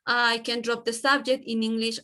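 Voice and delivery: ordinary talk, nothing marked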